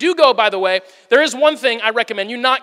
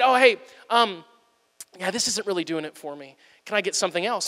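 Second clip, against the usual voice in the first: softer, lower, sheepish customer asking for another dish; 4 semitones lower, 7 dB quieter than usual. Retold or imitated. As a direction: mumble it like a guest too shy to complain. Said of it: "He said".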